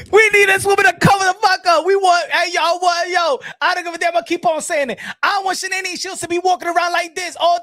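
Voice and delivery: worked-up comic tirade; ranting shout. Acted. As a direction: shout your outrage at the crowd. Ranting that she must cover up.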